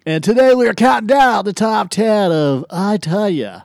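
fighting game announcer. Acted. Announcer voice continued.

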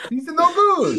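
high, loud hammed-up Italian accent. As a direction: squeal it in a cartoon Italian accent. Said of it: "could you do that in an Italian accent".

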